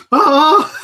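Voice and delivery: imitated yell shooting way up high and loud; mock angry yelp. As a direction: yelp like an annoyed dad, voice jumping an octave. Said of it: "his voice goes up".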